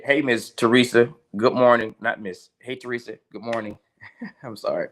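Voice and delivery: ordinary delivery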